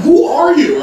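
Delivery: high, loud alarmed cry; stunned shout. Retold or imitated. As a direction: yell it as if she's a stranger. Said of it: "I'm looking at her like".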